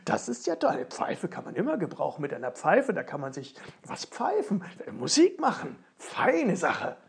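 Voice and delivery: laut, mit Lachen, hoch begeistert; begeistert lachend. Acted. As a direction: freut sich wie ein Kind über die Pfeife. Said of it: "rief es aus". (Ofenmichel jubelt über die gefundene Pfeife, lachend)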